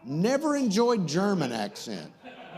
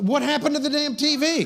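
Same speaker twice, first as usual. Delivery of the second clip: high, raised, exasperated question; exasperated outcry; 8 semitones higher, 4 dB louder, faster than usual. Real, not acted. Host to crew about the dead TV, exasperated outcry. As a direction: cry out at yet another mishap. Host notices TV has gone out.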